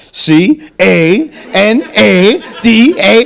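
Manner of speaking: letters spelled slow; spelled out slowly. Shown as a demonstration. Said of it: "the way they spell".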